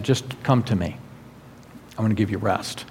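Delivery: soft, low, tender invitation. Acted. Jesus talking to discouraged, afraid people, tender invitation.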